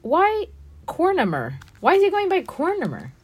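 high, loud exasperated outcry; swooping, over-the-top protest; mock dismay. Real, not acted. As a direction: wail in exaggerated disbelief. Mock dismay at a character's name.

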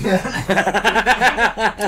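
a real laugh; hearty laugh. Real, not acted. Laughs at his own salesman joke.

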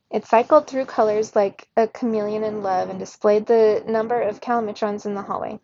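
plain reading voice

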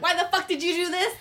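high, loud mock yell at a cheating partner; hammed-up furious demand; angry accusation. Friend acting out a partner's rage, mock angry yell. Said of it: "instead of you being like".

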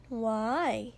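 drawn-out, leading question; knowing drawl. A narrator's reading. Friend fishing for the obvious reason, drawling. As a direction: draw it out as if you needn't ask. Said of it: "Mina drawled as if she shouldn't have to".

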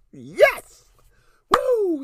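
very high, loud whoop; cheering. Real, not acted. Podcaster thrilled by the week's releases, loud whoop. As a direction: cheer like your team scored. Cheering about eventful week.

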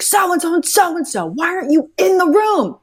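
high, loud impatient yell; imitating a rude casting director yelling at actors; mock yelling. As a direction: bark the names out like an irritated casting director. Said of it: "he would yell at people".

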